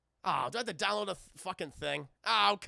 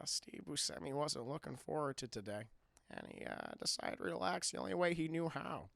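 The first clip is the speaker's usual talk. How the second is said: lower, softer storyteller voice; a hint of George Lucas; 5 semitones lower, 8 dB quieter than usual. Streamer reads erotic fan fiction to chat, lightly doing George Lucas. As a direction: read it low and dry like the director. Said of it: "in the George Lucas voice".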